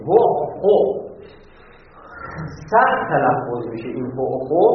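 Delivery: guttural Arabic هو، خو said with throaty Arabic sounds; Arabic accent. Shown as a demonstration. Teacher demonstrating hard-to-pronounce letters with Arabic accent. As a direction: say هو، خو with Arabic pronunciation. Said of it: "با لهجهٔ عربی باید بگیم".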